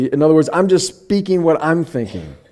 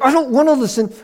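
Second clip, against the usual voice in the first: high-pitched petulant protest; stubborn retort; 11 semitones higher than usual. Retold or imitated. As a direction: dig in like a stubborn kid, pitch jumping up. Mimics own stubborn retort to wife.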